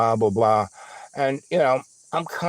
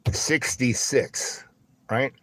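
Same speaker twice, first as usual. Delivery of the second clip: plain reading voice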